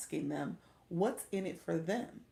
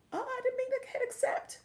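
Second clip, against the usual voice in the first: sudden high alarmed yelp; startled. Real, not acted. After accidentally accepting the battle, a high startled yelp. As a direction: caught off guard, voice jumping up.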